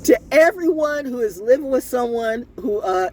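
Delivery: ordinary talk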